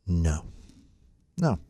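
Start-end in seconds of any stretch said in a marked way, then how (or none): none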